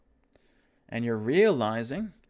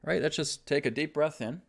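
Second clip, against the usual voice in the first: breathe-in, breathe-out coaching voice; guided breathing; 2 semitones higher, 5 dB quieter than usual. Shown as a demonstration. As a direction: lead them through each breath gently. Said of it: "let's try an example right now".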